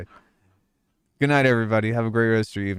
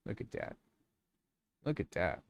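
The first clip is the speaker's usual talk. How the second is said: soft cooing; 13 dB quieter than usual. Real, not acted. Cooing at the dog.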